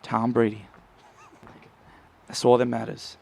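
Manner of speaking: a light Boston fan voice; Boston accent. Retold or imitated. Fan boasting about Tom Brady, in a light Boston accent. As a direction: keep the Boston fan bragging. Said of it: "Can I do a good Boston accent".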